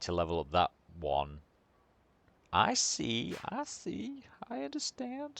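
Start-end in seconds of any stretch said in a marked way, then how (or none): 2.5-5.4 rising falsetto. Pretend. Player reacting to the upgrade menu, squeaky rising falsetto. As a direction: voice climbing ever higher into a squeak. Mock understanding, climbing into falsetto.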